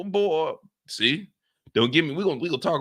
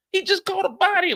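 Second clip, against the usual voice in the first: higher, hyped-up repeat; 10 semitones higher than usual. Real, not acted. Host hypes Drew's first body, excited. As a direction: say it like the news is huge.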